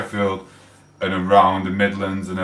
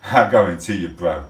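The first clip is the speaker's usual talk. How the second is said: ordinary delivery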